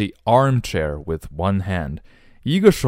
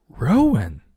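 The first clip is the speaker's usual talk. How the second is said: amazed mother; 5 semitones higher, 4 dB louder than usual. Acted. Mother amazed at changed child.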